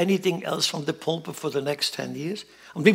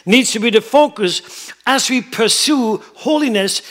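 loud, raised, emphatic sermon voice; forceful preaching; 6 semitones higher, 8 dB louder than usual. Real, not acted. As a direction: drive it home to the whole congregation.

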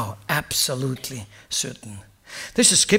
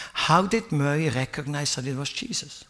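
normal talking voice

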